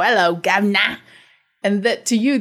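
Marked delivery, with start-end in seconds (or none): 0.0-1.0 put-on over-the-top British accent. Mimicking old radio comedy's fake accents, hammed-up British. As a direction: fake cockney-style British greeting. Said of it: "British accents, you know".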